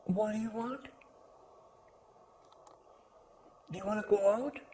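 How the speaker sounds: high, soft voice for a pet; talking to a dog. Real, not acted.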